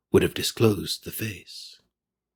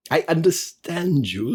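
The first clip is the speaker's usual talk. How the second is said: put-on higher, trembling old miser; frightened Scrooge; 7 semitones higher than usual. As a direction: plead with the spirit in dread. Scrooge answers the ghost.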